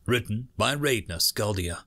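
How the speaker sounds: plain reading voice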